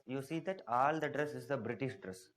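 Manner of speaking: ordinary talk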